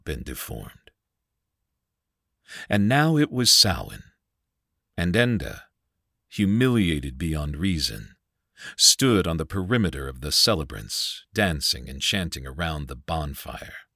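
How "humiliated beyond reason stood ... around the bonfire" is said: plain reading voice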